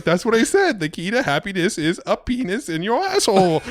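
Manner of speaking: high mock French accent, insisting; insistent French accent. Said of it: "he's like".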